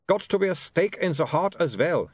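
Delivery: put-on high, accented vampire voice; vampire Otto. A narrator's reading.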